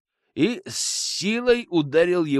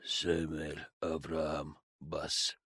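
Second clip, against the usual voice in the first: slow, much softer dull reply; hollow voice; 12 semitones lower, 14 dB quieter, much slower than usual. Acted. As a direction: answer hollowly, half-absent. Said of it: "глухо отозвался тот".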